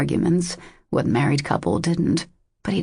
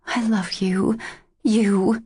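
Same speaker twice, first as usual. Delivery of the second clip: tortured whisper; 5 semitones higher, slower than usual. A narrator's reading. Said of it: "whispered in a tortured voice".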